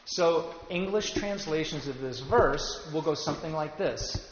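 normal talking voice

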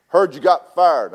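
a hint of a booming boss; lightly voiced boss teasing about the firing; boss's voice. Boss calling him on the phone.

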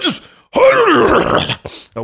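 words broken by a cough; coughing while talking. Real, not acted. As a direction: push the words out between coughs. Face pushed into spicy bag.

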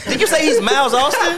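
laughing high taunt. Real, not acted. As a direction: tease while cracking up. Teasing through laughter.

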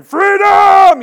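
huge, high-pitched roar; Braveheart battle cry. Retold or imitated. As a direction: bellow it like a charging warrior. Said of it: "He was Braveheart. He came out going".

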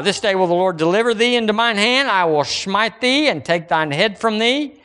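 high, declaimed Bible verse; scripture reading. Acted. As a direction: proclaim David's challenge from the pulpit.

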